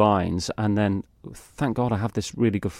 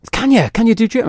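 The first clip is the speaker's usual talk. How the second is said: eager casting producer; 13 semitones higher, 6 dB louder, faster than usual. Retold or imitated. Retold producers asking eagerly.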